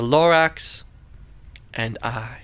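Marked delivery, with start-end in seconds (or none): 1.7-2.4 hushed. A narrator's reading. Lonely ending.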